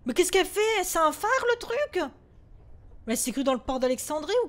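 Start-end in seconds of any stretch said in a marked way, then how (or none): 0.1-2.1 high, loud frightened questions; panicked. Real, not acted. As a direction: shout your panic at the thing. Panique devant le fantôme. (questions paniquées, aiguës et fortes)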